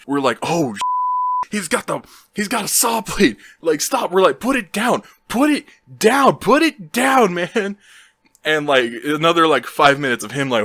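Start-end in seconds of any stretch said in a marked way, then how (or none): 0.4-4.1 retold high-pitched panic; panicked. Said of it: "we start panicking". 4.4-7.8 frantic high shouted command; panicked. Retold or imitated. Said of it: "we start panicking".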